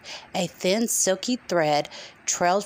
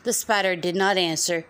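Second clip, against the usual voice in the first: ordinary delivery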